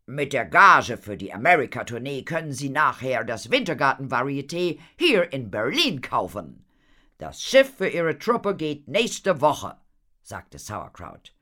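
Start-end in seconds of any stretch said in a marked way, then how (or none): 0.1-9.8 loud, animated showman's pitch; eager impresario Horatio. Acted. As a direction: sell the big plan with gusto. Horatio pitches his plan. (begeisterter, lauter Impresario)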